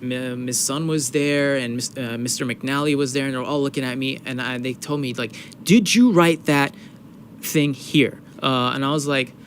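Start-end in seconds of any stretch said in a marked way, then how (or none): 5.6-8.2 slow, louder, pointed questioning; stern, deliberate, raised mock questioning; retold interrogation. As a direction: imitate a grilling, word by word. Acting staff interrogating him.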